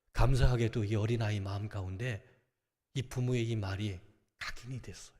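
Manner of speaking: ordinary talk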